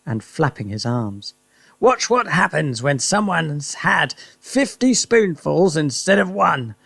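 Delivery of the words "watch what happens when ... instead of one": put-on high excited old man voice; husband boasting about the medicine; gleeful Mister Cranky. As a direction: crow with glee. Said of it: "Mister Cranky shouted".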